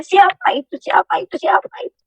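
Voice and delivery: bewildered question sinking to a hush; fading to a whisper. Retold or imitated. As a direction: let the question die into a whisper.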